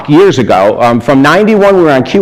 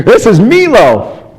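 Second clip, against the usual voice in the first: higher, slower, savoring each word; awestruck; 8 semitones higher, slower than usual. Retold or imitated. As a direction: say it like a fan who can't believe it. Repeats in awe.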